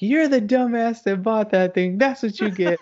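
high-pitched scolding gloat; imitating mocking wife. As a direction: jeer at him as his wife. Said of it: "She's laughing at me so hard. She's like".

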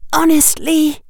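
put-on high, exasperated young girl's voice; acerbic. A narrator's reading. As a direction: say it with tart impatience. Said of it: "voice sounded a little acerbic".

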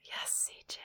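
hushed awed whisper. Real, not acted. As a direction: whisper it in awe. Whispering back.

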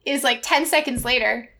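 normal talking voice

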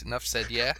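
normal talking voice

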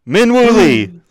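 high, loud name reveal; ringing, punched-up introduction; emphatic announcement. Real, not acted. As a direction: proclaim the name proudly. Re-announces his pick triumphantly.

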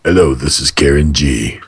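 low deep put-on hello. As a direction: drop the voice down low. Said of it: "you could go".

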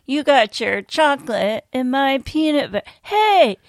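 high, excited ad-actor shout; commercial actor voice. Retold or imitated. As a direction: belt it like an old TV commercial. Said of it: "I remember that".

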